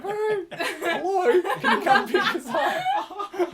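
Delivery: high, slow, loud sing-song voice; hammed-up polite request; silly phone call. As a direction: call the elevator like a friend on the phone. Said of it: "you don't literally, like".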